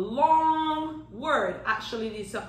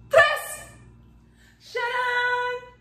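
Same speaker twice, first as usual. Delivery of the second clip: high, loud sung "tres, shut up"; excited reveal; 12 semitones higher, 3 dB louder, much slower than usual. Acted. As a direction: sing out the magic reveal. Magic countdown reveal.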